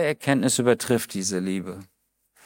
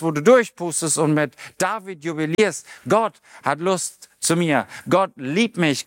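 usual speaking voice

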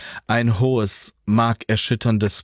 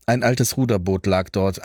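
plain reading voice

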